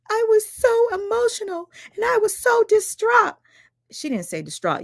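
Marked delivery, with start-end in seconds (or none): 0.1-3.4 high, shrill-free mock falsetto whine, much higher; mocking Ashley. Mocking Ashley's live where she claimed she was distraught, in a high mock voice. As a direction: mimic her fake emotional plea. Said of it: "where she said".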